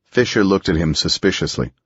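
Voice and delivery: plain reading voice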